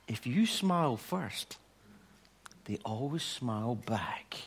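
whispered, slow and soft; secret whisper. Retold or imitated. Girl telling the boy her secret, whispered. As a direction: hush it like sharing a secret. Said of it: "She says".

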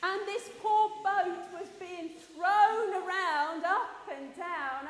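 loud, drawn-out storytelling; big, slow storyteller delivery; dramatic storm narration. A narrator's reading. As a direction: slowly heave the words out, loudly. Storm tossing the boat, told dramatically.

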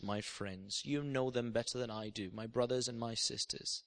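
low, soft prayerful voice; pastoral prayer. Real, not acted. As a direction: gently pray over the congregation. Gentle altar call and prayer.

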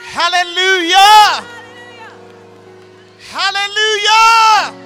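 ordinary talk, nothing marked

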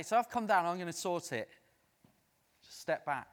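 a hint of a bossy rescuer; mock wrong version. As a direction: play the wrong God who says step aside. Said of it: "God doesn't say".

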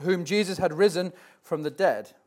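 ordinary talk

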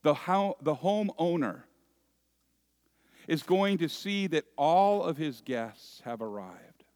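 normal talking voice

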